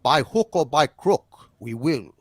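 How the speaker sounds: ominous, unhurried British villain; British accent. Acted. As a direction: draw it out in a measured British voice. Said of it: "like in the opening of The Prisoner".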